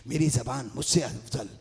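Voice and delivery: ordinary talk, nothing marked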